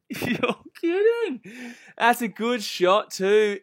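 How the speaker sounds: laughing disbelief. Real, not acted.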